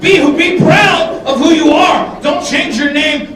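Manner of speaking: slow, loud rallying shout; mock sermon shout; shouted plea. Preaching to the crowd.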